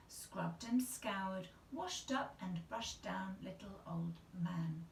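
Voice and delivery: ordinary delivery, nothing marked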